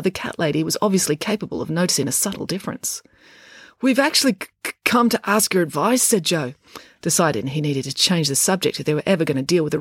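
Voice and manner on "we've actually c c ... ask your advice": higher, hesitant stutter; tentative, stumbling request; stammer. A narrator's reading. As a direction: get stuck on the C before continuing. Joe's stammer voiced in story.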